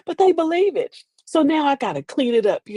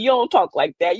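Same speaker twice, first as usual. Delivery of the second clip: normal talking voice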